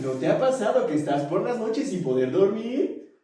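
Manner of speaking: infomercial host voice. Pretend. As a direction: sell it like a late-night TV ad. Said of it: "Me sentí como infomercial".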